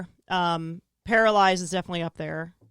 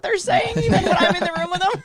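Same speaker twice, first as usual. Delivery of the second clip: higher, laughing through the words; 10 semitones higher, 3 dB louder than usual. Real, not acted. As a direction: crack up as you confess. Admits zoning out, laughs.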